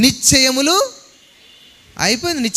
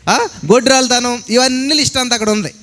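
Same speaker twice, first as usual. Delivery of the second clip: ordinary delivery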